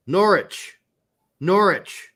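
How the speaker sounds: Norwich said "NORR-itch", silent w; British pronunciation. Shown as a demonstration. Correcting the English town's name, Norwich with a silent w. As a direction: say Norwich clearly with no w sound. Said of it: "Say it right".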